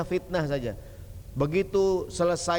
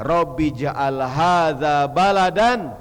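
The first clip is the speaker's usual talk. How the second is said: slow, loud chanted Arabic prayer; drawn-out Arabic prayer chant; Quranic prayer recitation; 7 dB louder, much slower than usual. Acted. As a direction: intone the verse slowly like a reciter.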